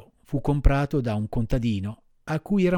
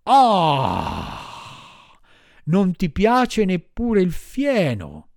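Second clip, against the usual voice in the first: loud, slow, higher bellow of a furious master; angry shout; 5 semitones higher, 4 dB louder, much slower than usual. Acted. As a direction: yell at the stubborn donkey. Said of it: "gridò il padrone imbizzito".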